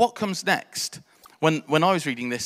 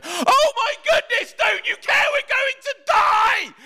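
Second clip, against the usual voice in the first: very high, loud, drawn-out terrified shout; shrieking desperate protest; panicked scream; 5 dB louder, slower than usual. Acted. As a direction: scream it in full panic at a sleeper. Acting out terrified disciples in storm.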